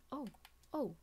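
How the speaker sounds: ordinary talk, nothing marked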